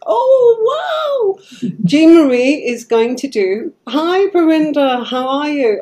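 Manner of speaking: very high, loud wow of delight; gushing over the guest joining; thrilled. Real, not acted. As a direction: squeal it out with joy. Thrilled the guest has joined.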